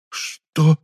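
high, loud, frightened question; alarmed, panicky disbelief; courage suddenly lost. A narrator's reading. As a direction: ask aghast, all bravado gone. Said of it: "вмиг растерял Хаосил всю свою смелость".

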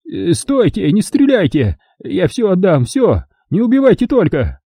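high, desperate begging for life; terrified pleading. Acted. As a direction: plead in panic. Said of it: "белугой взревел Вартан".

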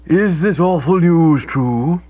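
hoarse tearful dowager on the phone; hoarse throaty voice. Acted. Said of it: "She then spoke in a hoarse, throaty voice".

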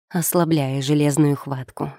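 plain reading voice

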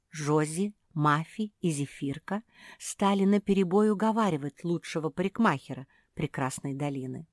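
plain reading voice

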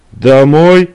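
indignant protest. Acted. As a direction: object to his leaving. Woman protests his leaving.

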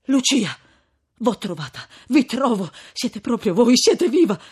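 loud, excited reunion cry; trembling exclamation. A narrator's reading. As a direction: exclaim in disbelieving relief, building. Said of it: "avanzandosi tutto tremante".

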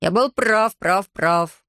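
insistent self-talk, repeating itself; muttering to himself. A narrator's reading. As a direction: repeat it to yourself like a fixation. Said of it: "бормотал себе под нос Хаята".

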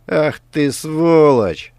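slow, loud furious cry; furious outburst. A narrator's reading. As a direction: spit the insult in rage. Richard leaps up in rage.

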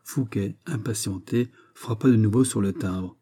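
ordinary delivery, nothing marked

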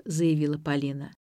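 ordinary delivery, nothing marked

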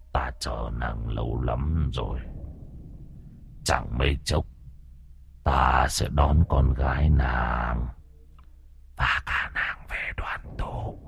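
low, slow, breathy demon whisper; ghostly whisper. A narrator's reading. The demon whispers its threat to the mother. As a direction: hiss it like a spirit in the dark.

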